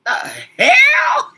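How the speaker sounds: high, loud laughing shout; laughing outburst. Real, not acted. Host reacting to vague kid talk, high laughing shout. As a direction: burst out in high cackling laughter.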